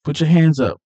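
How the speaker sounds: loud, high cop order; forceful command. Retold or imitated. Cop arresting the suspect, forceful loud order. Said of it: "with force".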